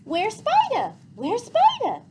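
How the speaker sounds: put-on high, loud little-girl chirp; cheerful toddler. A narrator's reading. Boots asking cheerfully about the spider, high toddler voice. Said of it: "Boots kept repeating".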